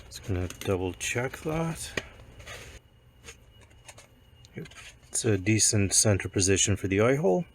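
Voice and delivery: hoarse croaky voice from a cold; croaky, a bit sick. Real, not acted. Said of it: "ignore the, uh, croaky voice".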